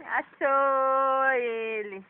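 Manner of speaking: slow, loud, stretched achou; drawn-out peekaboo reveal. Real, not acted. (achou bem arrastado)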